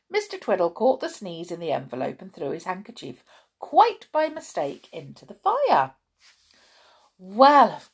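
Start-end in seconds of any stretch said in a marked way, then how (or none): none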